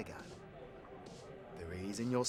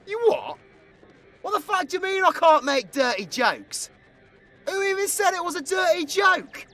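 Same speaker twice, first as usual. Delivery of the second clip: high, loud incredulous protest; indignant outburst; 8 dB louder than usual. Acted. Bartender objects to being accused of dirty jokes, indignant. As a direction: protest loudly in outraged disbelief.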